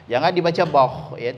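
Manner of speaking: ordinary talk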